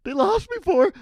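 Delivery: high, loud mock-kid shriek; imitated scream of shocked disbelief. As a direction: wail it like a devastated little fan. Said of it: "he starts screaming even more".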